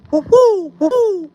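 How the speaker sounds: high hooting bird call, whoop woo; imitated bird call. Camper imitating the strange birds heard in the woods, high hooting call. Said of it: "It was like".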